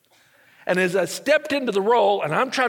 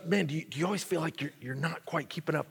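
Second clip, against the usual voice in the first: quiet probing question; 4 semitones lower, 8 dB quieter than usual. Retold or imitated. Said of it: "he goes".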